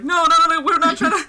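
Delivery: high, loud panicky no's breaking into a laugh; laughing mock protest. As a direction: protest frantically while cracking up. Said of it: "He's like".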